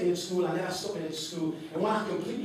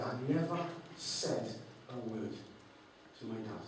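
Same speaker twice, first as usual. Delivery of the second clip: quiet; 6 semitones lower, 10 dB quieter than usual. Real, not acted. Emotional memory of auction.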